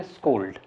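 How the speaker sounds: स्कोल्ड said as English scold, long o; teacher showing स्कोल्ड as scold; correct pronunciation. Shown as a demonstration. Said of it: "इसको हम पढ़ते हैं".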